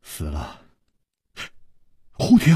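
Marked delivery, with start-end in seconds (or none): 0.0-0.6 very soft, low, resigned answer; hushed and deflated; sighing. Acted. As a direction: let it drop out on a sigh. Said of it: "楚留香叹了一口气说".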